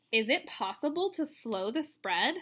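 ordinary delivery

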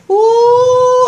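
high, loud thrilled ooh; excited ooh. Real, not acted. Meeting Braden, high loud thrilled ooh. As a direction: let the joy burst out.

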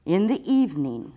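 ordinary delivery, nothing marked

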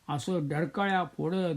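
ordinary delivery

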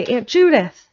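put-on boy shouting in delight at the tree; excited cry. A narrator's reading. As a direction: burst out with the name in joy. Boy sees Christmas tree.

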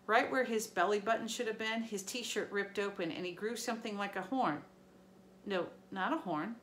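usual speaking voice

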